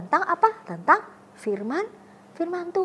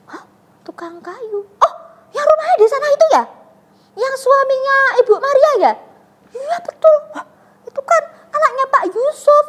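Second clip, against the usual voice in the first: much higher, louder squealing gossip; astonished gossip; 12 semitones higher, 8 dB louder than usual. Retold or imitated. Villager gasps about the carpenter's son, high and loud. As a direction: act shocked at the news.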